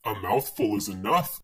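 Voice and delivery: low dragon snarl; low menacing snarl. Acted. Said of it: "snarled the dragon".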